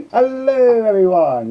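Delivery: a high, loud bright greeting; big hello. Real, not acted. Streamer greeting the chat at the start, big and excited. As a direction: open the stream with a bang.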